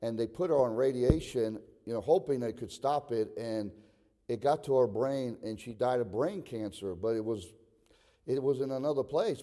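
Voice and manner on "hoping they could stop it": ordinary talk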